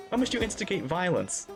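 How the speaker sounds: ordinary talk, nothing marked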